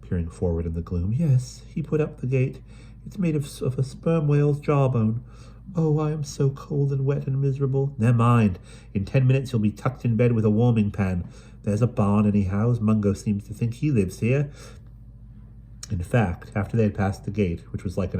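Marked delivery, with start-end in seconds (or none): 1.1-8.0 a hint of weary girl, a bit higher; tired, fed up with the island; mild drearily voiced girl; dreary sigh. Pen answers. 8.0-14.5 lightly voiced cockney street girl, a bit higher; brisk London urchin, chatty; Dido's voice. Dido reassures.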